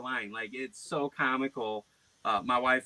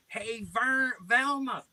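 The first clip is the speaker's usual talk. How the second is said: high, goofy tattling voice; put-on prank persona; 8 semitones higher than usual. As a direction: play a caller griping to Velma, pitched up. Plays prank character to Velma.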